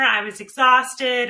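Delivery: ordinary talk, nothing marked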